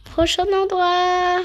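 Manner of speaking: slow, big announcer call. Acted. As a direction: announce it like a show host. Announcing next location.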